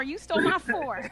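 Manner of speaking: ordinary talk, nothing marked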